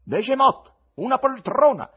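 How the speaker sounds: showman's welcome. Acted. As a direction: summon the cat with a flourish.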